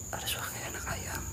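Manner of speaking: tense whisper; hushed, frightened whisper; nervous night vlog whisper. Real, not acted. Whispering about chick sounds.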